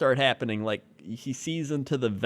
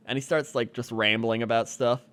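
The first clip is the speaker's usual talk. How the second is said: ordinary talk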